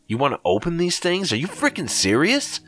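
high, panicky disbelief; alarmed high voice. Acted. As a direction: pitch way up in frightened protest. Narrator alarmed at friend.

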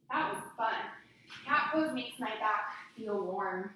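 normal talking voice

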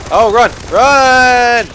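loud high panicked yell; being chased. Real, not acted. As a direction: scream at them to run. Fleeing enemies, shouting.

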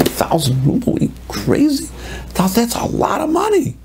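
high-pitched acted disbelief; incredulous outrage. Friend haggling over a thousand ruble demand, incredulous. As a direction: balk at an absurd price, voice shooting up.

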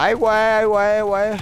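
high, loud cry of alarm; alarmed yell. Real, not acted. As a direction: cry out as you die. Getting killed in game.

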